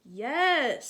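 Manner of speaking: loud, firm parental yes; firm mother. Acted. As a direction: put your foot down as the mom. Mother overrules Sophie.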